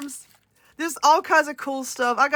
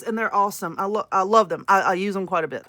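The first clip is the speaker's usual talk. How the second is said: ordinary talk, nothing marked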